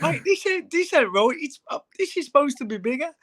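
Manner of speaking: very high squeaky disbelief; mock shocked high voice. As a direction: squeal it like you can't believe your eyes. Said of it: "I went".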